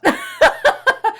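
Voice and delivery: loud high burst of laughter; shrieking laugh. Real, not acted. Joking about [name].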